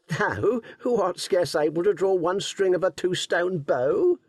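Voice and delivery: jeering rough woodsman; scornful, teasing forester voice; mocking forester. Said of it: "one who held a horn of ale in his hand said".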